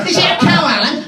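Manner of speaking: high, dim contestant voice; mock contestant. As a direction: play the clueless contestant.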